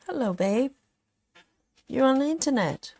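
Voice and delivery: cooing high at the cat; pet talk. Real, not acted. Owner cooing brightly to her cat on the livestream.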